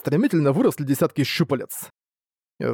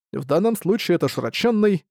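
ordinary delivery

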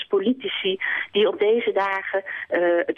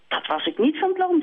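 higher, breezy refusing swan; teasing swan; 12 semitones higher than usual. Acted. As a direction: turn him down lightly. Zwaan refusing.

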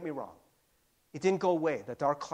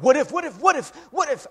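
anxious what ifs; 10 semitones higher, 12 dB louder, faster than usual. Retold or imitated. Retelling night-time anxious thoughts.

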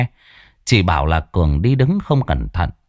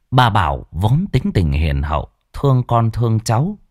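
usual speaking voice

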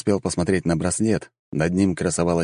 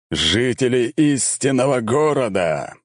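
powerful herald; 6 semitones higher, slower than usual. A narrator's reading. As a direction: address the whole city with majesty. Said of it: "совершенно другой голос глашатая".

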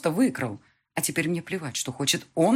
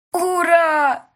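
put-on high, loud child's hooray shout; little boy throwing his arms up; child cheering; 13 semitones higher, 10 dB louder than usual. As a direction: son cheers for his mother. Son cheers.

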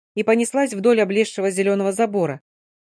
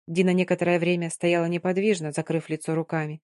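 usual speaking voice

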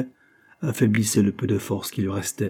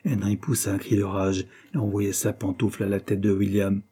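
ordinary delivery

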